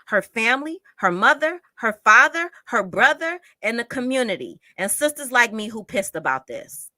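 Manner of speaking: rising anger at the police, firm and loud; loud angry reply to the police statement; angry retort. Real, not acted.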